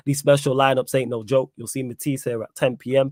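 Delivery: normal talking voice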